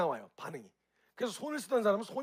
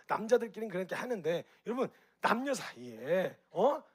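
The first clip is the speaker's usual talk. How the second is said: normal talking voice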